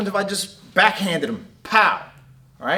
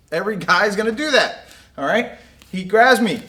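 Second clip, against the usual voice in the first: usual speaking voice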